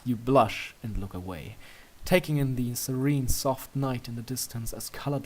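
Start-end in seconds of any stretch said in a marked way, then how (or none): none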